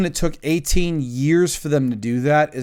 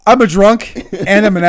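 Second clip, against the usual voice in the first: ordinary talk, nothing marked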